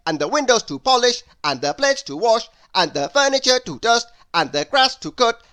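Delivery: loud, high, brisk list of chores; bossy Mister Busy. A narrator's reading. Mister Busy loudly listing every chore for Lazy. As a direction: rattle off the chores like a busy boss. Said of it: "added Mister Busy".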